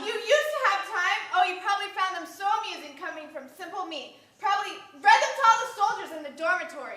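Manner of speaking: loud, wounded reproach; bitter accusation. Acted. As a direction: heap on the blame, hurt and heated. Acted quarrel, she accuses him.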